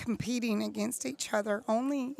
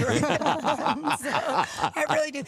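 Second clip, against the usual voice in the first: words carried on real laughter; louder, laughing through the admission; 3 semitones higher, 4 dB louder, slower than usual. Real, not acted. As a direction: laugh as you admit it. Laughing while admitting it.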